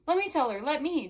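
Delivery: high, loud, insistent begging; eager boy. A narrator's reading. Jamie shoves in beside Mel to tell Wanda, eager. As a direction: butt in like an excited kid.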